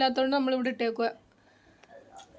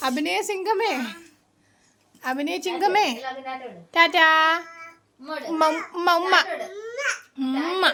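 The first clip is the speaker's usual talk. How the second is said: cooing to pet; 4 semitones higher, slower than usual. Real, not acted.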